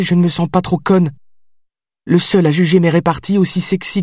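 high, heated and unrelenting; high heated outburst, listing what he means to her; outraged. Acted. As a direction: pour it all out at him, wounded. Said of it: "outrée par mes reproches".

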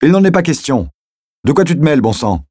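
high-pitched, exasperated retort; heated quarrelling protest; angry refusal. Acted.

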